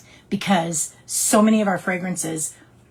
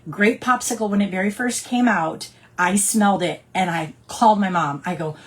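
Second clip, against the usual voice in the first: ordinary delivery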